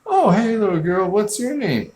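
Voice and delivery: a hammed-up friendly teenage girl greeting a kid; sweet girly voice. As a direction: coo it like a teen talking to a small child. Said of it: "and she's like".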